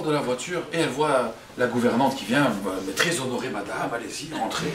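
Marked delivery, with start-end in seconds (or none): 2.9-4.7 soft, low, courteous welcome; deferential servant. Acted. Governess welcoming the new mistress, soft deference. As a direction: usher someone in with quiet deference.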